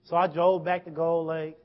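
ordinary talk, nothing marked

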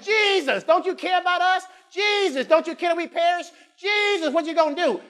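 high, pleading cries of Jesus; panicked disciples. Retold or imitated. Disciples in the storm crying out to Jesus, panicked and high. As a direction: beg Jesus like you're sinking. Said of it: "They was like".

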